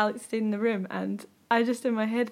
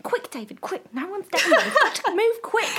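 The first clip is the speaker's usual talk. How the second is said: mock urgent old woman; 10 semitones higher, 3 dB louder than usual. Imagined old couple rushing.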